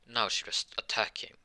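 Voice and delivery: plain reading voice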